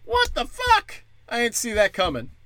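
squeaky high cartoon outrage; mock disbelief. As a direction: shriek the question in fake shock. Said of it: "he's like".

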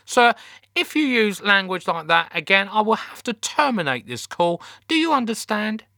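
high, officious telling-off; teenage call-centre girl. A narrator's reading. Teenage call handler warns a swearing captain, high and officious. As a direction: scold the caller by the rulebook. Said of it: "who sounded in her teens".

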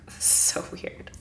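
breathy whisper to herself, half-voiced; whispered aside. Stylist whispering her shock to herself. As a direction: hush it like a private thought. Inner reaction during odd reading.

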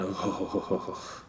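low, hushed mock moans; mock moaning oh. Friend mocking a zebra's reaction with low moans. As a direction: drop way down into breathy mock moans. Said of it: "I'm not going".

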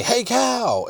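calling out high and soft; mock call. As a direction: call to the animal. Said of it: "and you say".

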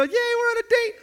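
high-pitched hammed-up cheer; exaggerated giddy delight; mock glee. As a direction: squeal with put-on date-night excitement. Acting out delight at date.

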